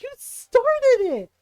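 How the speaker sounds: high, loud, drawn-out comeback; indignant retort. Retold or imitated. Teller to cousin who blames mom, indignant. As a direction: throw the blame back, stretching it.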